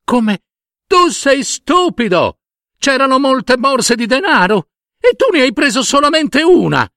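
very high, exasperated wife; outraged crying out. A narrator's reading. Wife berates husband for taking one purse, high outraged cry. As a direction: berate him at the top of the range. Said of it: "gridò sua moglie".

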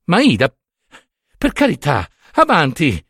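acted weary plea; pleading. A narrator's reading. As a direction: beg her to move on. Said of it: "pregò lui a mani giunte".

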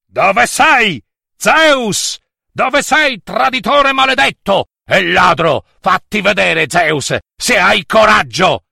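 high, forceful enraged shouts; furious yelling. A narrator's reading. Enraged father hunting his daughter's abductor, yelling. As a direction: yell a challenge into the cave. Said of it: "entrò urlante nella grotta".

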